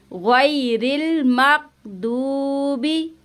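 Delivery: very slow, मगदूबी with ghain held in the throat; don't turn ग़ into ग in मगदूबी. Shown as a demonstration. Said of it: "हलक के दरमियान में गैन की आवाज़ को रोकना है". (मगदूबी में ग़ैन हलक़ से)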